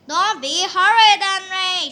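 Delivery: loud, high angry shout; shouting mom. A narrator's reading. Mom shouts at Henry, loud and angry. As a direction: yell it at the naughty boy. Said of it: "she shouted".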